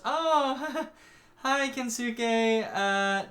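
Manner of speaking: high-pitched, nervous-laugh politeness; awkward greeting. Retold or imitated. Guardian caught off guard at the door, awkward greeting. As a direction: fake a friendly hello with an awkward laugh.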